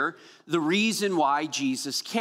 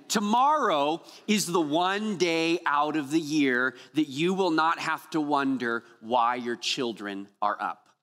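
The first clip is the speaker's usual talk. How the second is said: normal talking voice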